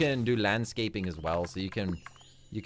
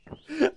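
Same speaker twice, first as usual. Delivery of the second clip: high squeal of laughter; 6 dB louder than usual. Real, not acted. Laughing at the dropped sheep.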